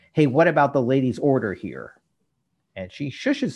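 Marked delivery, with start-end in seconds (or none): 0.1-1.9 mild mock shout, a little raised; retold shout. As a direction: call out half-heartedly for service. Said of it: "then he shouts".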